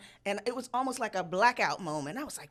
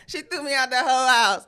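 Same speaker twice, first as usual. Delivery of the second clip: laughing; 6 semitones higher, 9 dB louder than usual. Real, not acted. Laughing correction.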